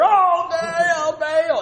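high, loud wailing cry; frantic prophets crying out. Acted. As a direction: howl the name. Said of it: "So they cried louder".

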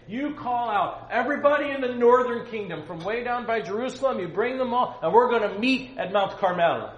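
high, loud prophet's summons; Elijah's shouted challenge. Acted. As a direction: call out the challenge to the king. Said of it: "So he's saying".

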